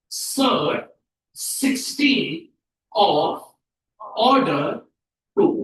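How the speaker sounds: long gaps between the words; word-by-word dictation. Shown as a demonstration. Teacher tells class what to write, word by word. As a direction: dictate it one word at a time. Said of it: "तो हम इसको क्या लिखेंगे".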